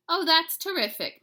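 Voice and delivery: high, loud mother's voice; mock delight. A narrator's reading. Mama humoring her son, high and loud. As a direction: play along like an amused mom. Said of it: "said Mama".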